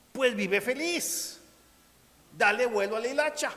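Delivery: high, lilting voice of a pleasure seeker; carefree epicurean. Retold or imitated. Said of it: "que dijeron, bueno".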